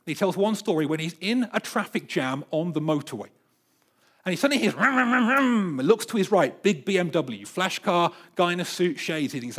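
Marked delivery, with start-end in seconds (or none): 4.7-5.8 high, loud vocal engine rev; revving engine. Retold or imitated. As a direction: rev it like a flashy BMW. Imitating a sports car engine revving.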